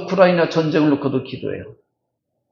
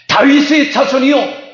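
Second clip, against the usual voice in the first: louder cry; 7 semitones higher, 3 dB louder than usual. Acted. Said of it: "더 큰 소리로 외치게 됩니다".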